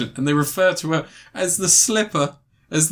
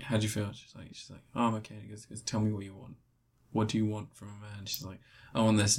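sultry voice; 5 semitones lower, 12 dB quieter, faster than usual. Retold or imitated. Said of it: "sexy R and B voice".

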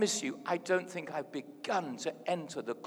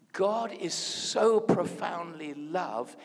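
usual speaking voice

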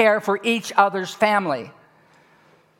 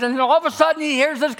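normal talking voice

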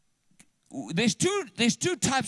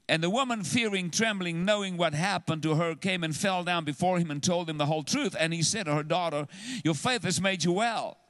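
lower, measured Bible reading; scripture reading; 4 semitones lower, faster than usual. Acted. Pastor reads the healing verse to his congregation, lower and measured. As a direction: read the verses out to the church.

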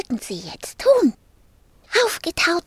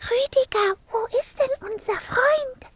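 put-on high, questioning little-girl voice; 10 semitones higher than usual. A narrator's reading. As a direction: ask in a child's high voice. Girl's voice in searching dialogue.

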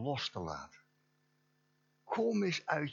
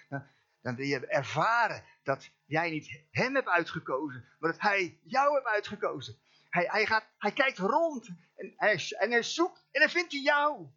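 high, loud, rising preacher voice; fiery preaching; 10 semitones higher, 5 dB louder than usual. Acted. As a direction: build it up like a revival preacher. Preaching builds to full force.

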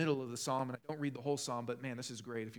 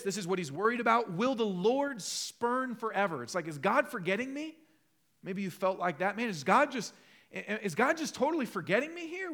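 loud, high, impassioned preaching; fervent preaching; 7 semitones higher, 6 dB louder than usual. Acted. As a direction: press the point home to the congregation. Preaching the psalm.

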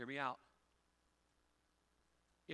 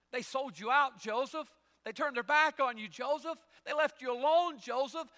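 loud, much higher, repeated jeer at Joseph; shouted taunt; 12 semitones higher, 15 dB louder than usual. Acted. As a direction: hammer each line with his name. Doubters mocking Joseph, preacher voicing.

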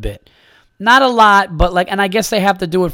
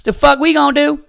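much higher, louder whooping cowboy yell; Texan yee-haw; 13 semitones higher, 9 dB louder than usual. Pretend. Cowboy shooting cap guns from the car window, whooping Texan accent.

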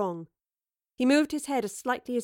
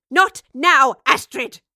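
loud, high, irritated rebuke; snapped; 4 semitones higher, 12 dB louder, slower than usual. A narrator's reading. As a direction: shut her down with an angry shout. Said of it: "snapped the professor".

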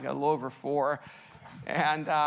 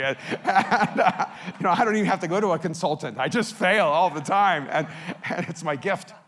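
laughing; 8 dB louder than usual. Real, not acted. Joking about own failures.

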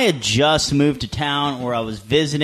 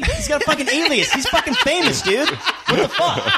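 mock exasperated, much higher, laughing through it; indignant comeback; 13 semitones higher than usual. [name] scolding the bouncer, laughing mock outrage. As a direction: scoff as if the bouncer is an idiot. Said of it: "without fucking blinking".

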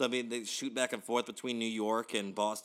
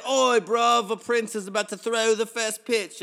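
high, loud mock-British geezer; bad British accent; 10 semitones higher, 10 dB louder, slower than usual. Fan heralding the prince's pitch, hammed-up British accent. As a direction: put on a hammy British accent. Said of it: "And that's my British".

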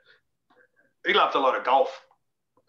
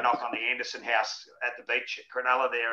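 ordinary talk, nothing marked

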